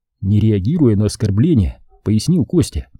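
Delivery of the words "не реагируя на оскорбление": usual speaking voice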